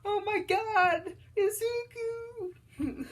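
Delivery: very high, drawn-out squeal of a mom; mock freak-out. Mom seeing her son bring someone home, mock freak-out. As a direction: squeal it loud and slow, imitating an overexcited mom. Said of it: "his mom's freaking out".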